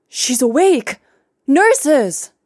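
high, loud call for the nurses; excited shout. Acted. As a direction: shout it out in joyful alarm. Grandfather calls nurses, overjoyed.

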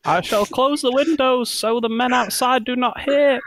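high, tearful mock voice; hammed-up high wail; distressed guardsman. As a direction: play a whimpering guardsman covering up the news. Plays the guardsman.

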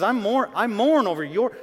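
high, loud urgent plea; urgent outcry. Retold or imitated. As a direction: call it out high and loud as Paul. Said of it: "He says".

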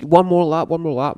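high, loud cheer of encouragement; egging him on from the sidelines; supporters yelling. Retold or imitated. Friends at the rail yelling at the runner, loud cheer. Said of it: "And they're going, like".

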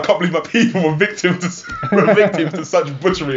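hammed-up offended protest; mock outrage. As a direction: play aggrieved while cracking up. Acting Randy's outrage, laughing.

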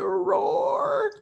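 very high, feeble lion roar; small whimpering mock roar; crying child roaring. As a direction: imitate a tearful little boy's roar. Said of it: "he's kinda crying".

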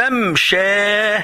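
slow "ممشى", mam-shaa with a long final a; open fatha ending. Shown as a demonstration. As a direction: stretch both syllables of "ممشى". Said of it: "ممشى بالفتح".

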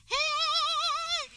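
very high, sudden cry of relief; relieved yelp. Retold or imitated. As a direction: let out a high joyful shout. Passport arrives, relief.

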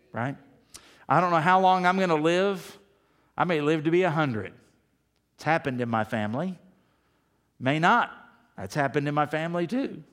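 normal talking voice

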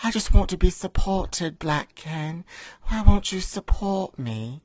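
put-on a light English royal accent, pleading; a hint of British royalty, needy and sad; mock English accent. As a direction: Diana begging Ken in a touch of posh English. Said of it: "Your accent, your accent isn't quite English".

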